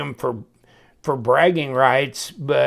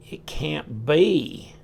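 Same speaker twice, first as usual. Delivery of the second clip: drawn out, still doubting; lingering slow doubt; slow disbelief again; much slower than usual. Real, not acted. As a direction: slowly repeat it, half to yourself. Surprise at the D-shaped pivot.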